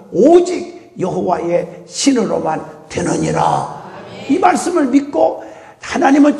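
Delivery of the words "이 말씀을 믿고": usual speaking voice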